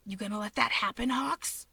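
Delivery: hushed, smug challenge. A narrator's reading. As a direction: dare him with a smug lilt. Shigaraki taunting.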